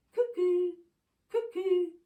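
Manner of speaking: high bird-like cuckoo call. Acted. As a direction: sing it out like a cuckoo. Cuckoo calls again.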